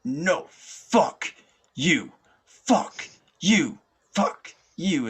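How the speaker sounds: loud, high-pitched re-enacted yell. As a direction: yell it in bursts, fending off something grabbing you. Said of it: "I'm yelling at it".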